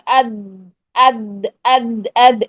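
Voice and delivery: اد with the tongue pressed at the front, like a dal; wrong front-placed ضاد. Shown as a demonstration. Teacher shows students the mistake, wrong front-placed sound. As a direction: say اد thin, off the tongue tip. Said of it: "یہ بنے گی آواز۔ نہیں، یہ نہیں".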